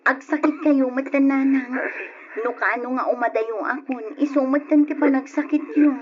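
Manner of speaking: loud, pleading outburst to the parents; upset shout. Acted. Son shouting at his parents before going abroad, upset.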